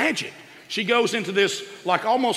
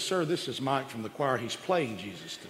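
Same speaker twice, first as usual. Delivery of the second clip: quiet; 6 semitones lower, 10 dB quieter than usual. Retold or imitated. Usher explains to the comedian.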